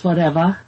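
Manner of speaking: "whatever" said as "whatevah". Shown as a demonstration. Giving an example sentence, "whatever" with an "ah" ending. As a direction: drop the final R of "whatever" for "ah". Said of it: "the R's are like a ah sound".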